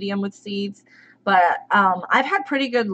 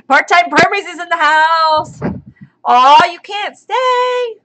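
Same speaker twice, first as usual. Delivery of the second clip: high, loud, delighted welcome; excited greeting; 8 semitones higher, 6 dB louder, slower than usual. Real, not acted. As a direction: cheer the viewer in. Greeting a viewer to the stream.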